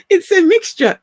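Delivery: giggling while admitting it; laughing. Real, not acted. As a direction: laugh it out, amused at yourself. Laughing at her own accent.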